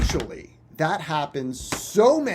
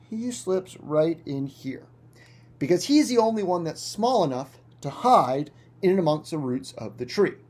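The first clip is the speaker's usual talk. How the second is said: normal talking voice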